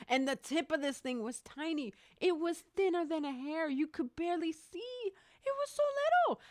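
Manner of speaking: very high, small voice; squeaky amazement. Real, not acted. Storyteller describing a hair-thin needle, high-pitched amazement. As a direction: pitch it way up for something tiny.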